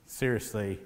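low, soft inner voice; murmured to oneself, pitch dropped; private thought. Acted. Prophet thinking to himself on the way in, low private thought. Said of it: "wonder what he was thinking".

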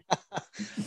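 brief high chuckle; a quick amused laugh; short laugh. Real, not acted. Host laughs at joke.

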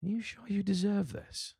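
high, slow, needling voice of self-doubt; inner doubt voice. Retold or imitated. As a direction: voice the nagging doubt, high and drawn out. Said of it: "doubt creeps into me and goes".

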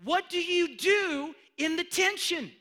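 high, loud, slow cry out; drawn-out preacher's call; preaching cry. Real, not acted. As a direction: cry out the question to the room.

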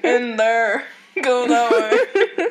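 slow, droning undead directions; zombie voice. Retold or imitated. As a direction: point the way like a zombie. Zombie worker directing, in character.

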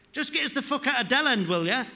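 loud laddish cry of escape; rowdy shout. Acted. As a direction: belt it out to the whole gang. Lad shouting over the mates.

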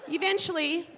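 ordinary talk, nothing marked

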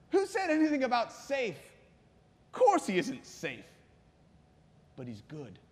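put-on English storybook voice; English accent. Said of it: "I'll do an English accent here".

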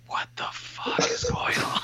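high breathy whispered cat; startled cat voice. Retold or imitated. Cat's reaction voiced in a story.

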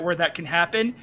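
ordinary talk

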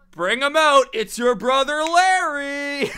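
loud, high showman reveal; game show host. Retold or imitated. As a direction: announce it like a TV host.

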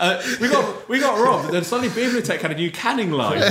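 high, loud, laughing all the way through; can barely tell the joke for laughing. Real, not acted. Joking to the crowd that the guest brewery robbed them, laughing.

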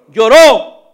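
a sudden loud, ringing "Lloró"; loud proclamation. Acted. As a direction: proclaim the single word with force. Preaching the shortest verse. (proclamación fuerte)

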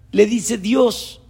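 ordinary talk

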